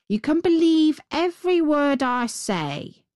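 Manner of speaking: high, insistent crow voice; talking crow. A narrator's reading. Crow vouches for his tale, high-pitched crow voice. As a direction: lift the pitch as an assuring crow. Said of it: "said the crow".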